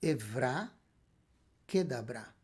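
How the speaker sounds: Evra Kedabra in two separate, deliberate pieces; teacher carefully presenting Evra Kedabra as Hebrew; Hebrew pronunciation. Shown as a demonstration. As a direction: say Evra, pause, then Kedabra, stressing each final syllable. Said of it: "A frase em hebraico é".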